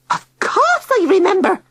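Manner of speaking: very high, loud screech. A narrator's reading. Tilly screeching at Digby in indignation. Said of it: "she screeched".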